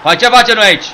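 loud, high fired-up shout; rally the lads after scoring; triumphant boasting. Real, not acted.

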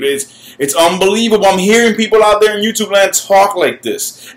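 usual speaking voice

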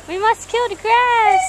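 high, slow mock-solemn antelope; female antelope mafia member. Second antelope answering at the mafia meeting, high drawn-out declaration. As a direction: pitch it up and draw out the decree. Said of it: "she's like".

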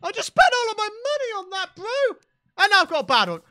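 high, loud shouted complaint; frustrated outburst. Real, not acted. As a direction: yell it in exasperation. Money wasted on bad roll.

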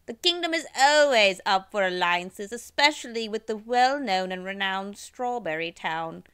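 grand royal announcement; royal proclamation. Acted. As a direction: proclaim for the kingdom.

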